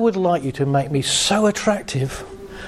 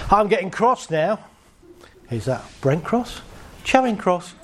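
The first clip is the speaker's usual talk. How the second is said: ordinary talk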